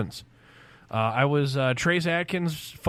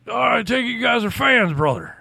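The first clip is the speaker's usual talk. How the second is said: Hulk Hogan impression; 7 semitones higher, 3 dB louder than usual. Wrestler greeting a young fan, Hulk Hogan impression. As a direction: put on a wrestler's voice. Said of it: "He goes".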